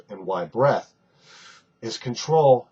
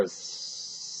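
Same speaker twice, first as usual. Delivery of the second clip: long steady hissing s; drawn-out s like a snake; 14 dB quieter than usual. Shown as a demonstration. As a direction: hiss out an even s like a leaky tire. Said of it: "like a snake or a leaky tire".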